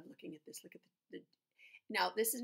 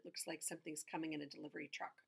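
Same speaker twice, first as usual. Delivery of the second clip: usual speaking voice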